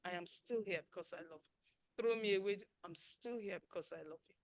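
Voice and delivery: a hushed promise of loyalty; tender vow. Shown as a demonstration. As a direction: keep saying it gently, steadfast. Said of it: "Don't let those just be words".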